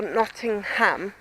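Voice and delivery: Nottingham slowly, split into Notting-HAM; careful pronunciation. Shown as a demonstration. Vlogger correcting herself for viewers, careful drawn-out Nottingham. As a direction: spell out every syllable of Nottingham, full "ham". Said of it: "sorry, southern accent gets in the way".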